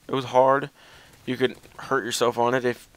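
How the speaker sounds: usual speaking voice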